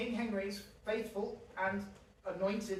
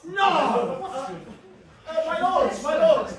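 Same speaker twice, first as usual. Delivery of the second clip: loud, higher cry, no mock in it; alarmed outcry; 4 semitones higher, 12 dB louder than usual. The king crying out to his lords over the lost duchies. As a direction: call the lords to stop at once. King interrupts the treaty reading.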